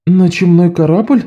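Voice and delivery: high puzzled question. A narrator's reading. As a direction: ask in disbelief. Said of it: "недоумённо нахмурилась София".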